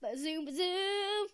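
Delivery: high, punchy bazoom, bazoom; sound-effect blast on bazoom; excited. Shown as a demonstration. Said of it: "Can you say bazoom, bazoom again?".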